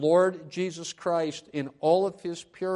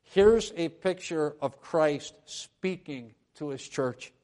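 ordinary talk, nothing marked

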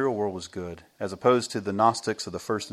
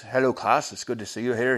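put-on Irish accent. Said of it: "He has this Irish accent".

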